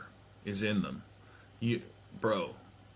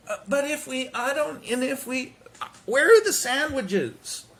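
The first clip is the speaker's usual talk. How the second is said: high, flustered mom voice that gets louder at the sandwiches; frazzled mom; 14 semitones higher, 4 dB louder than usual. Retold or imitated. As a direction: play a panicking mother who has lost track of everything. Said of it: "just, like, frazzled".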